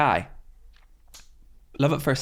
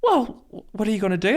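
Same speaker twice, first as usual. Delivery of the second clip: mum's voice; 11 semitones higher, 3 dB louder, faster than usual. Retold or imitated. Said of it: "she was like".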